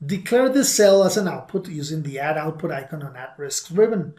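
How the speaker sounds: usual speaking voice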